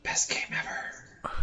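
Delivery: breathy sarcastic whisper. Acted. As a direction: hiss it out slow, mocking. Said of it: "use the big bold letters".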